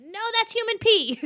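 loud, high warning call; mock alarmed shout. Mom warning daughter jumping in puddles, mock alarmed shout. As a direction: call out a hammed-up parental warning.

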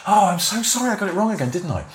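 very high-pitched, put-on contrition; gushing fake sorry; mock apology. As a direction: imitate an exaggerated, insincere apology. Mimics presenter's insincere apology.

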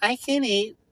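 high, whiny mock reply. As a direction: mimic the vague friend. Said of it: "Are you hungry or not".

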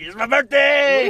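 high, slow, drawn-out yell. Retold or imitated. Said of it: "just yelling in the back".

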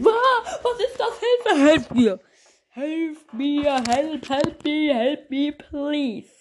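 high, hammed-up panicked cries for help; mock panic. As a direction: scream for help while laughing. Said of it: "Jeder normale Mensch".

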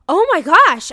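a loud, high burst of joy; imitating a thrilled actor's delight; excited gasp. Imitating Mandy Patinkin thrilled by the anchor's news, loud and high. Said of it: "And Mandy Patinkin is like".

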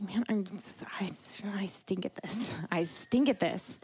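hushed, breathless whisper of defeat; whispered self-pity. Retold or imitated. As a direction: whisper it like a private confession. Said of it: "I was like".

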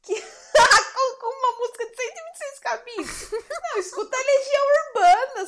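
high, giggling objection; laughing protest. Real, not acted. [name] refusing the sad song, laughing protest.

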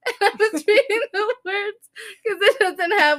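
high voice squeezed by laughter; words bubbling out through giggles; laughing while talking. Real, not acted. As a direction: tell it while cracking up. Cracking up over own funny story.

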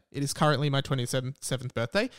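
usual speaking voice

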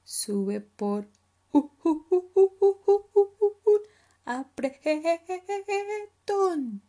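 hooting, chattering monkey; loud, high, stretched monkey call; monkey character. Acted. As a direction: stutter the words like monkey whoops.

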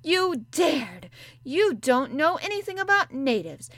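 put-on enraged child yelling; raging. A narrator's reading. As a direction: lash out in humiliation. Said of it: "did not even try to control her rage".

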